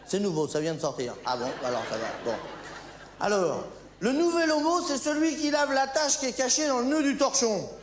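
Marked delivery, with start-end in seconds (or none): 3.2-7.7 ad announcer. Acted. Parody of a detergent ad.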